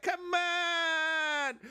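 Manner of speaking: high whooping shout; mock whoop. Cheering along with the pundit, high mock shout. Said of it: "And I was like".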